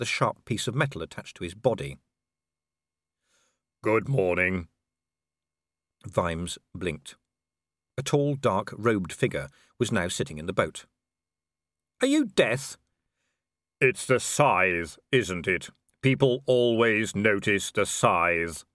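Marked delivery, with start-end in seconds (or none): 3.8-4.7 sombre figure in an altered voice; Death. Acted. Robed figure greets Vimes in the boat, sombre Death voice. 12.0-12.8 high, taken-aback query; startled question. Acted. Vimes questions the robed figure in the boat, startled. 13.8-18.6 slow, even, sombre otherworldly voice; Death. Acted. Robed figure answers Vimes in the boat, slow sombre voice.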